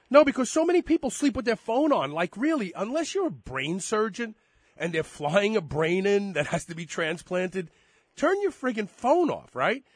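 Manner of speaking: loud, high, worked-up rant; excited rant. Real, not acted. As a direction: get fired up and push it out loud. Ranting about phones left on.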